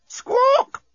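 very high, loud bird squawk; parrot screech. A narrator's reading. The unseen parrot screeches again.

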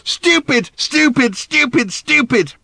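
high, repeated yells; furious outburst. A narrator's reading. Daughter yells at her father before running off, furious. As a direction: hammer out each one in a rage.

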